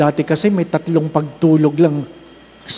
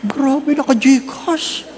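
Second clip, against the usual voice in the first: amazed disbelief; 9 semitones higher, much slower than usual. Retold or imitated.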